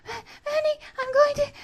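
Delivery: very high, loud breathless cry. Acted. Alice overwhelmed, crying out very high and breathless. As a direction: cry out high, losing control.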